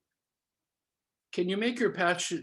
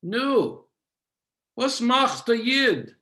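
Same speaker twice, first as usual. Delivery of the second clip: put-on higher, louder, slow Yiddish greeting from an old family friend; old friend's greeting; 8 semitones higher, 6 dB louder, slower than usual. Grandparents' old friend greets him in Yiddish, higher and louder. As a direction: call out the question like a hearty elder. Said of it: "that voice is saying in a greeting".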